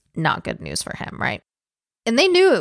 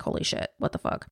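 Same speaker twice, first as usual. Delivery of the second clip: stunned whisper; 10 dB quieter, faster than usual. Retold or imitated. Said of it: "'cause they're like".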